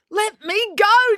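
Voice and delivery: high, slow, insistent demand; high, forceful demand. A narrator's reading. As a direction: insist on it, drawing out each word. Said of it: "demanded April".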